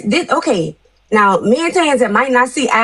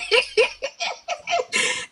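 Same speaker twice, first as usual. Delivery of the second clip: breathy unvoiced laugh; 11 semitones higher, 9 dB quieter than usual. Real, not acted. Laughing at the yelling man.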